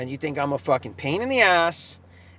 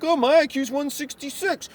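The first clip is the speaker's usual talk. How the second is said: higher, smug mock voice; mocking the doctor; 9 semitones higher than usual. Imitating the doctor bragging about his IQ, smug. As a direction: make him sound pompous. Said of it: "He's like".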